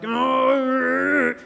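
high exasperated "oh"; a fed-up inward groan. Retold or imitated. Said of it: "all this kind of going on in your head".

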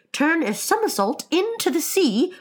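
high, wildly eager call; excited cry. Acted. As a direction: cry it out high, caught up in the dance. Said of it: "cried the Mock Turtle".

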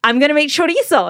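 proud dad. Retold or imitated. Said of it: "he's like".

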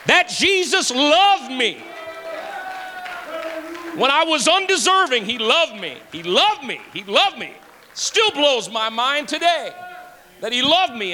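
high, stretched, emotional preaching; impassioned. Real, not acted. Preacher telling the church that Jesus loved him, impassioned. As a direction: cry it out, overwhelmed by his love.

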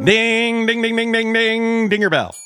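high loud game show bell; ding ding bell. Pretend. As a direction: ring it out like a quiz buzzer. Game show correct answer bell.

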